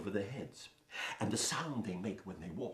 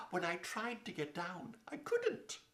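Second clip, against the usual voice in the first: put-on girl's voice; 9 semitones higher, 4 dB quieter than usual. Girl voiced in story.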